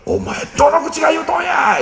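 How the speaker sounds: imitating mother, high and tearfully furious; a mother snapping back through tears; retold cry of outrage; tearful anger. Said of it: "泣いて怒り始めたんです".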